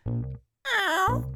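high rock scream. Pretend. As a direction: screech like a rock singer. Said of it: "trying to scream like [name]".

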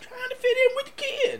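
mocking, very high squeal. Co-host teases host about skinny jeans, mocking falsetto.

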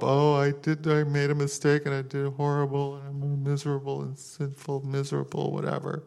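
high, droning self-pitying moan; mock moping. Preacher imitating someone starting to mope, mock moping. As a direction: wallow in it, high and whiny, mocking. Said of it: "every time you start to mope".

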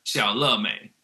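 "xiao le mei" with a light L sound, tongue loose and forward; Taiwanese xiao le mei. Shown as a demonstration. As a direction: say "le" lazily, tongue falling out. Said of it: "and they say "le".

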